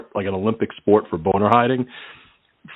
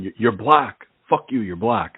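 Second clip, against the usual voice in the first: ordinary talk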